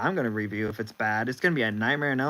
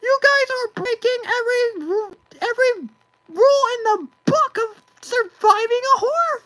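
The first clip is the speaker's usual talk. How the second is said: much higher cartoon mouse, aghast and outraged; 8 dB louder than usual. Steamboat Willie upset with viewers over horror movie, much higher cartoon mouse voice.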